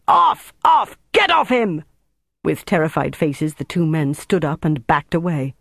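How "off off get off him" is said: very high, loud screamed orders; screamed command. A narrator's reading. Officer with pistol orders men off captive, screaming. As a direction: scream at them to back away. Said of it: "he screamed".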